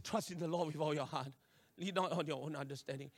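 low, soft scripture murmur; subdued recitation. Retold or imitated. Learner steadying himself with scripture before test, subdued. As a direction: recite it quietly to steady yourself. Calmly reciting instead of wailing.

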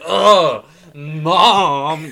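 put-on loud whiny teenage groan at mom; sulky teen groan. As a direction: groan it like a teen told to do chores. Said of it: "like, a fifteen-year-old boy whose mom tells him".

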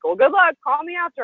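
high, chirpy mock good-luck; imitated cheery send-off. Mentor to her before the dinner, mock cheery send-off. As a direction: toss it off high and breezy.

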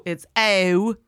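"O" stretched into a gliding triphthong; Maryland vowel. Shown as a demonstration. Explaining the home Maryland accent, "O" as a long glide. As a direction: draw "O" out through several vowels. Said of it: "it's, instead of".

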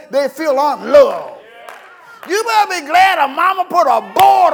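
loud, high preacher's shout, building; full-force preaching. Real, not acted. Preacher defends strict mothers to grown children, shouted preaching. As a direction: drive it home, hotter with each phrase.

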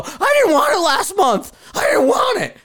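high, loud, tearful mock whine; whiny complaining customer. Forum owner mocking a customer who whines to Netflix, high crying whine. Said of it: "I don't go call Netflix and go".

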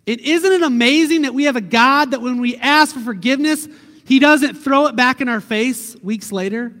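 loud, high preacher's cry; impassioned preaching. Acted. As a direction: lift it up and proclaim it to the church. Preaching at full force.

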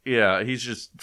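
ordinary delivery

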